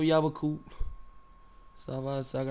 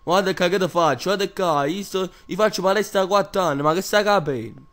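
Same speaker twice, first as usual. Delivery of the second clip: loud boasting; 4 semitones higher, 6 dB louder than usual. Acted. Boasting macho threat, loud.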